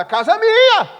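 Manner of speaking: shouting in outrage at the prophet; indignant shout. Acted. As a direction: shout it's your house, outraged. Man protests in his house.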